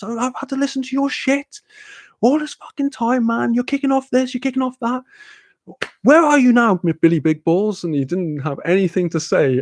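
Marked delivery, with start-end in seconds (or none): 0.0-7.8 high-pitched indignant rant; retold kicking off. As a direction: re-enact telling someone off, pitch right up. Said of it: "I just kicked off so hard".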